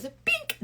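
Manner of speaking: tiny high-pitched mock hammer tap; playful bink. She playfully taps him with pretend hammer, playful squeak. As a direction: squeak a silly little bonk. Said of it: "being playful".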